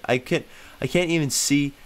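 ordinary talk, nothing marked

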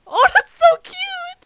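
very high, loud, drawn-out squeal; overwhelmed gushing squeal. Real, not acted. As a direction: melt loudly over something adorable. Reacting to streamer noticing the raid.

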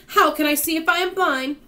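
loud, high exasperated protest; shouting. A narrator's reading. Blind earthworm rounds on James, shouting. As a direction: yell the question in outrage. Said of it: "shouted the poor earthworm".

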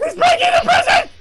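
high, loud alarmed yell; panicked shout. Real, not acted. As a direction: scream the warning out. Monster wrecking the prison.

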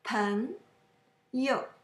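朋 rising, 友 short and neutral; neutral second syllable. Shown as a demonstration. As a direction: lift 朋, leave 友 unstressed. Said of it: "The yo is neutral".

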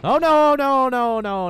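high, loud wail easing down; panicked. Real, not acted. Player in trouble before saving, panicked wail. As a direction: start in alarm and let it sink. Fleeing in game.

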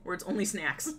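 ordinary talk, nothing marked

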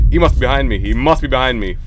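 usual speaking voice

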